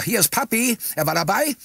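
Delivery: high, excited greeting; hasty blurting. A narrator's reading. Narrator greeting his children on camera, hasty blurting. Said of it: "stieß ich in großer Hast hervor".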